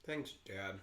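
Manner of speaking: ordinary delivery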